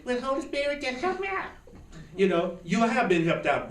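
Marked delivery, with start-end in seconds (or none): 0.1-1.5 high, whiny pleading prayer; hammed-up helpless prayer voice; mock lazy prayer. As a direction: make the lazy plea sound silly and high. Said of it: "It didn't happen because I just".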